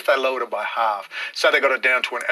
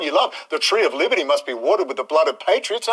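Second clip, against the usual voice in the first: ordinary delivery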